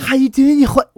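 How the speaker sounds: high, loud Scouse impression; mock Liverpool accent.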